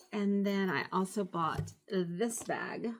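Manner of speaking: ordinary talk, nothing marked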